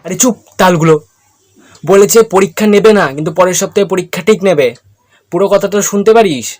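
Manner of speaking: loud, exasperated telling-off; angry scolding. Acted. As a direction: yell at him to shut up. Scolding friend for not listening.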